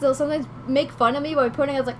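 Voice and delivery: usual speaking voice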